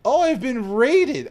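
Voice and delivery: high, loud, slow exclamation; drawn-out high-pitched surprise; loud realization. Real, not acted. Realizes the stream was raided.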